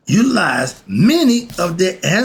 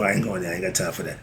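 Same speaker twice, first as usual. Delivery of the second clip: muttered aside; 10 semitones lower, 9 dB quieter, much faster than usual. Real, not acted.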